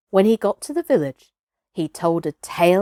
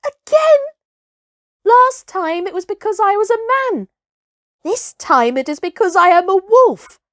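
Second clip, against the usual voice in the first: indignant cry; 12 semitones higher, 6 dB louder than usual. Acted. Mowgli indignant at being cast out.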